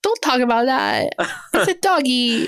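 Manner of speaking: put-on high pleading baby voice; baby talk. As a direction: coo it over a puppy. Pleading for the dog.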